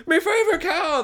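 very high wail; hammed-up high-pitched dismay; mock-distraught farmer. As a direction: wail it like a farmer over a lost pet. Farmers find their cow dead.

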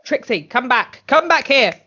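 calling someone back, rising to a shout; a hint of a pleading yell; calling after her. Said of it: "He wasn't".

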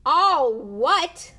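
high, very loud outraged roar; thundering. A narrator's reading. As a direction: thunder the question back. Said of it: "Hagrid thundered".

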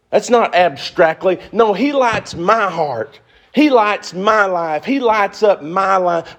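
high, loud impassioned preaching; full-force preaching. Real, not acted. Preacher lifting the congregation in high, loud preaching. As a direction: preach it out, rising and ringing.

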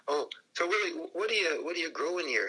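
high-pitched retold question; curious small talk. Driver asks Willie about his greenhouse, acted curious small talk. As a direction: set up the joke with innocent interest.

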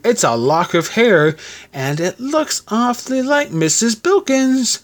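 slow, high-pitched teasing insistence; indignant protest. A narrator's reading. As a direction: draw it out slowly, pitched high. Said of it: "protested Tom indignantly".

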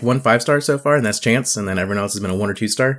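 ordinary delivery

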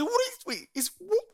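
high, startled double take. Retold or imitated. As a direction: jump at the surprise. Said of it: "I said".

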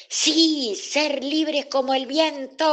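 very high, long-drawn exultant cry; shouting. A narrator's reading. Dolores dreaming of flying free, shouting. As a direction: yell it out high and loud, stretching the end. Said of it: "Dolores gritaba".

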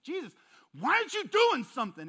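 high, loud exasperated demand; frustrated outburst. Retold or imitated. Worried believer venting at Jesus, high and loud. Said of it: "Jesus is like".

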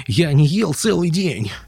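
high, slow plaintive whine; hungry, self-pitying whimper; whining. A narrator's reading. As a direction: moan it piteously, drawn out. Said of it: "захныкал он".

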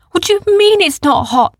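put-on an astonished child questioning; boy voice. A narrator's reading. Arthur questions Oren about the lava, high and loud. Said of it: "said Arthur".

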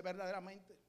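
low, soft agreement; wife's quiet remark. Retold or imitated. Wife quietly agreeing with the preacher's words, soft and low. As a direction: murmur it knowingly.

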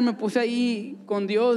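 normal talking voice

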